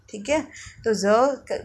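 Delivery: ordinary talk, nothing marked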